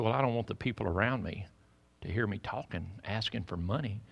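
softly voiced hushed plea; hushed caller. Caller at the church softly asking the pastor for money. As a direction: lightly play a woman asking quietly. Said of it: "Why are you whispering?".

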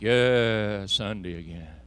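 mock weary grumble about Sunday; weary complainer. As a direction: sigh it out like a tired churchgoer. Mocking a churchgoer's tired complaint.

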